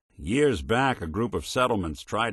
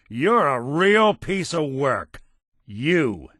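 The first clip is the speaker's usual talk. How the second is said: higher, louder, drawn-out disgust; angry contempt; 5 semitones higher, 6 dB louder, slower than usual. Acted. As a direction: lean hard and slow into the insult. Character angrily condemns the partner.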